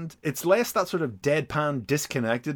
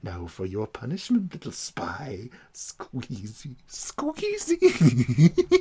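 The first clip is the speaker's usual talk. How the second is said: menacing villain; slower than usual. Retold or imitated. Villain punishing snake.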